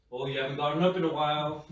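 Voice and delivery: quick, chirpy gadget voice reading a reminder; smartwatch voice. Retold or imitated. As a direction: rattle it off like a cheerful watch alert. Smartwatch nagging him to stand.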